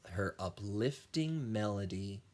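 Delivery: plain reading voice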